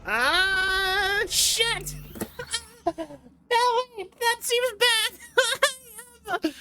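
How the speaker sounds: startled gamer yelping in panic; panicking. Real, not acted. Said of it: "I'm done panicking".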